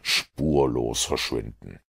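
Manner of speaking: calm low-voiced detective, measured; cool, composed Sherlock Holmes. A narrator's reading.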